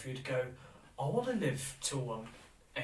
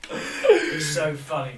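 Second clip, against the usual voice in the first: louder, slowed by real laughter; laughing; 3 semitones higher, 12 dB louder, much slower than usual. Real, not acted. Recalling the beach story, laughing.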